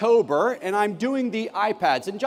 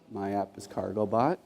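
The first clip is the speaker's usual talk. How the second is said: put-on quiet shy boy; 12 semitones lower, 7 dB quieter than usual. Quiet boy presents his app.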